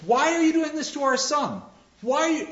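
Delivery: high, pleading cry to the gods; pleading ghosts. Retold or imitated. Ghost parents challenging the gods for their son, high and pleading.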